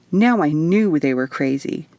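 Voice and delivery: ordinary delivery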